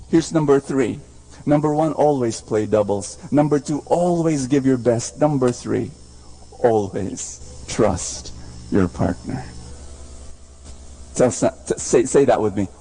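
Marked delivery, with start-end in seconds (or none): none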